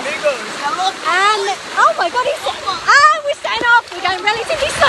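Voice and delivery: high, excited ski-race commentator; frantic sports commentary. Acted. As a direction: call it like a live race. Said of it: "we're both gonna commentate now".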